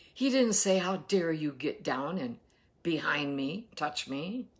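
usual speaking voice

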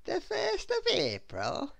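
high, slow, drawn-out question; very much surprised. A narrator's reading. Little Miss Scatterbrain learns the date, very much surprised. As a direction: ask it slowly in high-pitched astonishment. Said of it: "very much surprised".

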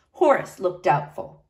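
ordinary delivery, nothing marked

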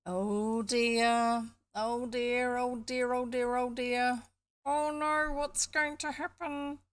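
high, drawn-out wailing of oh dears; fretful character. Acted. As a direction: play-act a worrier fretting on one held high note. Fretful character reacts to danger.

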